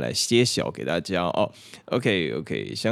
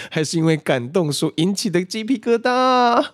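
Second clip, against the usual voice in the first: high, loud, hammed-up emotion; mock dramatic; 13 semitones higher, 6 dB louder than usual. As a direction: overplay being moved. (夸张激动)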